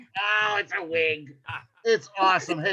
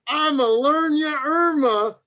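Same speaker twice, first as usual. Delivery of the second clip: hype; 4 semitones higher, 6 dB louder than usual. Acted. Host hyping the comic's name.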